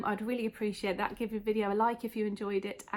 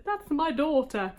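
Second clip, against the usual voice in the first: imitating a proud mother, slow and higher; retold proud boast, pitched up; proud mum; 5 semitones higher, slower than usual. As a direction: draw it out with mock maternal pride. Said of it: "they were really proud".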